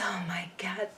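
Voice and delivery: breathless whisper, barely there; awed whisper. Real, not acted. Remembering [name]'s voice on stage, an awed whisper. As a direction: whisper it in awe.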